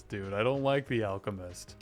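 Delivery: ordinary talk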